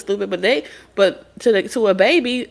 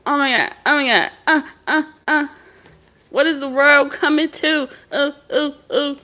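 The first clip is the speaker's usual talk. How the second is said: lightly voiced worried mother, a little higher; fretting mama; 6 semitones higher than usual. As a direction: play a hint of a panicked mom. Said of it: "She used to be running around".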